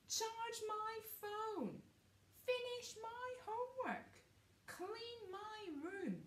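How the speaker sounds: high, slow, drawn-out demand; bossy brother. Acted. Brothers ordering Cinderfella around, high slow bossy demand. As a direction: whine the order like a spoiled brother.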